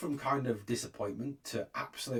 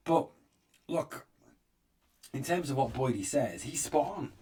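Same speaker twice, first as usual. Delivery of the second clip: ordinary talk, nothing marked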